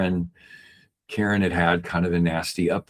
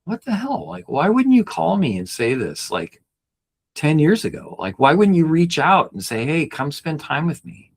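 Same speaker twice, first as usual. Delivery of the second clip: angry; 9 semitones higher than usual. Retold or imitated. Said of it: "at first I was mad".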